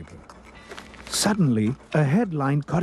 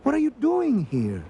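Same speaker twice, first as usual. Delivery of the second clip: high-pitched, astonished asking; amazed question; 11 semitones higher than usual. A narrator's reading. Ralph finding Eduardo captive, high surprise. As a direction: ask in surprise, pitched right up.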